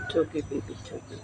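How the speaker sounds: soft, hushed comfort to a tiny puppy; tender soothing. Real, not acted. (malambing na pag-aalo sa tuta)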